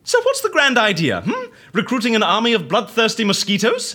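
high, loud sarcastic taunt; mock the idea with a laugh. Amelia mocks the scientist's plan, high and sarcastic.

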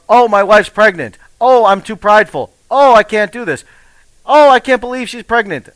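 mock the excuses in a whiny voice. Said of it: "my excuses of".

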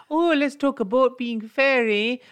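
high, loud mock Canadian accent. Mocking Canadians on a podcast, high mock Canadian accent. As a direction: send up a cheery Canadian voice. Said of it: "I can't do my Cana-, Canadian accent too long".

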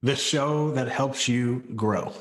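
slow, deliberate podcast slogan read; slow tagline delivery. Pretend. Cohost delivers the podcast slogan on cue, slow announcer read. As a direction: land the show's catchphrase like an announcer. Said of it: "as [name] ... is going to, uh, tell ya".

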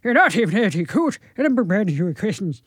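muddled attempt at soft Irish; hard-to-follow mock-Irish lilt. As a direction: put on a gentler Irish brogue, words garbled. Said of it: "A softer Irish".

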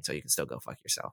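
soft unvoiced whisper. Real, not acted. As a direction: hiss it under your breath. Whispered aside after "just kidding.

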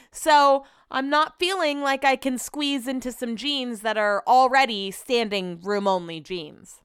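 normal talking voice

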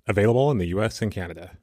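upbeat sponsor ad read. Acted. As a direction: read it like a podcast ad.

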